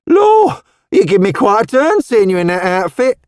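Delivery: put-on high, lively Cockney old woman; Cockney charwoman. A narrator's reading. Missus Tubbs startled by Roseanne's outfit, high lively Cockney.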